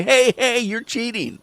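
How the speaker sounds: high, loud "Hey, hey"; mock accusing shout. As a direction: call out the cheater in a high, loud voice.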